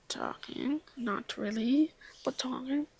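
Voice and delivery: coy unvoiced whisper. Real, not acted. Answering viewers about the ferry guy, whispering. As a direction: tease it in a whisper.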